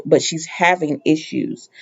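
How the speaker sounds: ordinary talk, nothing marked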